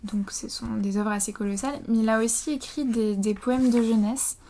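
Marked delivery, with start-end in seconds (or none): none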